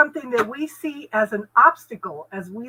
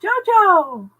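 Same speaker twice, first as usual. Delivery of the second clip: loud high call across the room; 9 semitones higher, 7 dB louder than usual. Real, not acted. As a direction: call her name out loud. Calling out to a friend.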